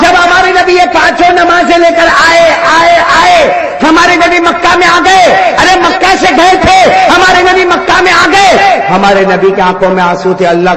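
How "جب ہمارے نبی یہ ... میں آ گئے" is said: loud, high preaching that builds with each number; full-force preaching. Acted. Preacher counting the prayers down to the crowd, loud and rising. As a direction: call it out to the crowd like a fiery preacher.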